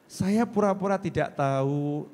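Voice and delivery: ordinary talk, nothing marked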